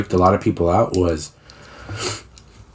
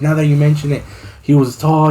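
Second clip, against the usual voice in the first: high, louder imitation of an alarmed mother; mom panicking; 7 semitones higher, 3 dB louder than usual. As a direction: act out her worked-up realization. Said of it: "My mom fucking panicked".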